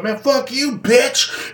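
high, sneering cuss-back; hammed-up shouted comeback; mock angry retort. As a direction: fling it back at the other driver. Other driver cusses back.

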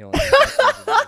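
laughing. Real, not acted. Laughing complaint about his friend.

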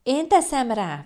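high, loud, drawn-out protest; slow, high, insistent yell; child's squeal. A narrator's reading. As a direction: squeal it like a stubborn toddler. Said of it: "visította Palkó".

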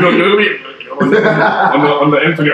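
loud, high, laughing burst; giggling, loud protest; excited interruption. Real, not acted. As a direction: jump in excitedly.

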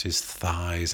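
plain reading voice

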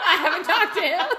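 high, loud laughing admission. Real, not acted. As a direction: giggle the words out.

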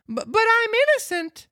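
very high stuttering protest; scared boy pleading; stammering puppet. Acted. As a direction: Pinocchio pleads innocence to the officers. Pinocchio voice.

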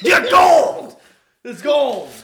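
high, loud excited praise. Acted. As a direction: rave about the take. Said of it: "the director's like".

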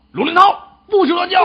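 high, loud scolding shout; mock yelling. Dog owner acting out scolding his dog, high loud yell. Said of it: "我就冲他大叫".

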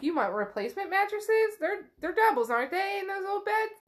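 high-pitched, eager questioning; eager to help. Acted. Pierce jumping up to handle the mattresses, high and eager. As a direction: pitch it up, keen to please.

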